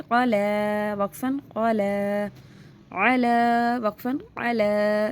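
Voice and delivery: क़ाला and अला with a light l and a long final vowel; thin laam drill. Shown as a demonstration. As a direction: stretch the ला endings, keeping them thin and unrounded. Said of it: "मैं बारीक पढ़ रही हूँ इस लाम को". (ला को बारीक रखकर खींचें)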